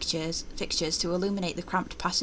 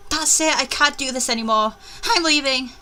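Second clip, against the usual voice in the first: panicked; 5 semitones higher, 5 dB louder than usual. Acted. Character panics and quits.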